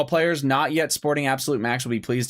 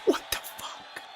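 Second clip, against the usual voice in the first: whispered; 8 dB quieter, slower than usual. Real, not acted. Confused by the muted audio.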